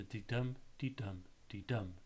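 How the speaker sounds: "de-dum" with a light first beat and a strong second; teacher chanting "de-dum"; de-dum rhythm. Shown as a demonstration. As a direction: tap out "de-dum" like a heartbeat, stress on dum. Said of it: "it's an unstressed beat followed by a stressed one".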